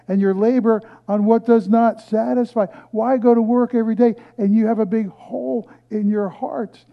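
high, loud prophetic call; high, loud scripture proclamation, slowing on listen; preaching at full force. Acted. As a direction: thunder the prophet's plea.

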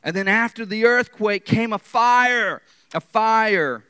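booming, high-pitched shout; thunderous preaching. Acted. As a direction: thunder it out over the crowd. Preacher booms the disaster account.

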